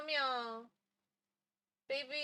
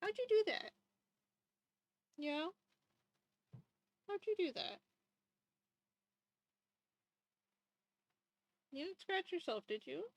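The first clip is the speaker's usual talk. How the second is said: higher, softer questioning; pet talk; 4 semitones higher, 4 dB quieter, faster than usual. Real, not acted. Owner asking cat about his scratch, soft pet talk. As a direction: gently quiz a pet.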